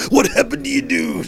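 high loud wrestler shout; wrestling promo. Retold or imitated. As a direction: bellow it like a promo. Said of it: "he gets up there".

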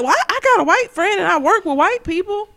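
high-pitched mock self-defense; mock excuse. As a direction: pitch it up, imitating a lame excuse. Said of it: "it ain't gonna be like".

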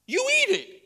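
high, loud mock parent snap; a parent sternly refusing to swap the cereal. A father telling his little daughter to eat her cereal, mock stern. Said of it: "parents, you're like".

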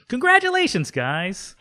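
very high, loud cheer; exuberant congratulations. Acted. Noel congratulates his colleagues, high excited cheer. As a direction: burst in with high-pitched congratulations. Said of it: "And he goes".

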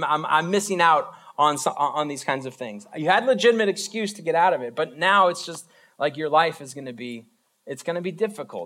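usual speaking voice

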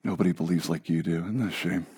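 soft, low whisper in your ear; tempting whisper. Retold or imitated. Preacher voicing the lie in your ear, soft and low. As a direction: coax him quietly like a tempter. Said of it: "that little whisper in your ear".

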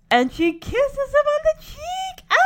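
high-pitched fangirl squeal building into a scream; excited squeal. Real, not acted. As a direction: get carried away by the kiss. Fan squealing over a kiss scene.

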